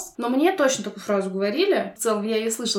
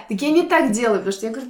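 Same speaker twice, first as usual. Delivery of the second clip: ordinary talk, nothing marked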